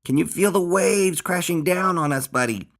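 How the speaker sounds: high, loud storm shout; urgent high-pitched yell. A narrator's reading. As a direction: shout over crashing waves. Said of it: "shouted Earl".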